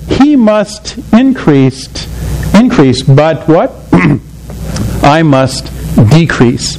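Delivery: slow, deliberate reading of the key verse; solemn recitation. Acted. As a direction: let each word of the verse land slowly. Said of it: "those words that I hope you have underlined".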